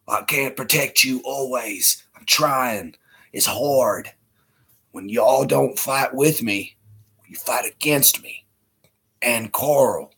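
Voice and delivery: gruff lawman pleading for his son, dropping to a whisper; pained sheriff voice fading into hoarse whispers; Rick Grimes impression. Viewer asks for Rick Grimes, tense whispered pleading to Coral. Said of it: "how Rick always squinches his face".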